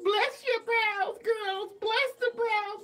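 high, loud hammed-up preaching; mock preacher blessing. Vlogger playfully blessing brows like a preacher.

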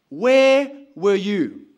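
very loud, drawn-out accusing demand; loud reproach. Acted. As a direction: cry it out slowly, demanding an answer. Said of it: "grabs hold of him, and says".

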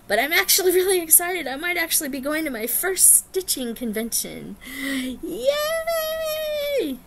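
high, giddy outburst ending in a squealed yay; real excitement bubbling up into a long, very high "Yay!"; excited. Real, not acted. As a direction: get carried away with excitement, high-pitched. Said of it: "didn't mean to get all excited".

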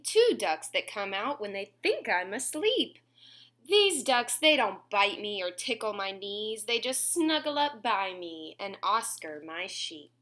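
put-on lilting read-aloud to kids; sing-song storybook reading; reading to children. A narrator's reading. Reading a bedtime rhyme to little listeners, sing-song.

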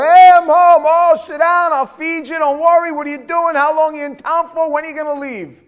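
high, loud, bubbly welcome home; excited mother greeting. Retold or imitated. Mother welcoming her son home, loud excited gushing. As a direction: gush over him at the door.

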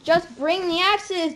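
loud, high, slow urgent cry. Acted. Jack flees the giant, crying out to mother. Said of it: "Jack cried".

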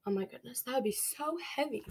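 plain reading voice

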